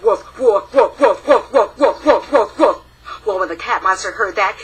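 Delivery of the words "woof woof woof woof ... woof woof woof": steady, moderately loud woofs; playful barking woofs; dog barking. Acted. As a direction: bark like a dog in the woods. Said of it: "sounded exactly like this".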